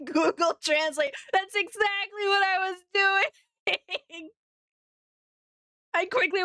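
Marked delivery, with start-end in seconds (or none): 1.3-6.4 laughing high, slow, through the words. Real, not acted. Streamer caught googling the translation, laughing while admitting it. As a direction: giggle it out, caught red-handed.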